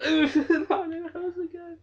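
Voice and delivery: half-laughing, half-crying whine; slow whiny nervous laugh-cry; whimpering dread. Real, not acted. Dreads entering the house again.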